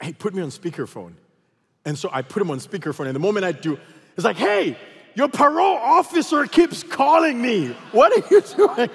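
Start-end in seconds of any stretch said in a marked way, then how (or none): 0.0-1.1 softened, conspiratorial phone voice; lightly hushed, as if plotting; imitated whispering. Friend on the phone setting up a prank, mock hushed voice. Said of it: "So he starts whispering". 4.4-8.9 high, loud hammed-up yell; mock shouted scolding. Friend on speakerphone embarrassing him before pastors, mock shout.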